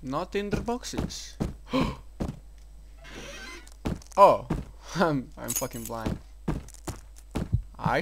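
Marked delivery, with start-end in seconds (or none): 1.4-4.1 sharp airy high gasp; startled gasp. Real, not acted. Player caught off guard by something in the dark, gasps. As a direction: gasp at the sudden scare. Sudden scare in horror game.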